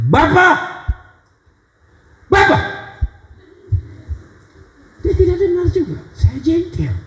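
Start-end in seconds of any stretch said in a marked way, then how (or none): 0.1-2.6 shouting high and loud to be heard; loud call. Retold or imitated. Preacher yelling at a deaf old seller. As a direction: yell the name so he hears. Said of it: "saya pakai suara akan dengar".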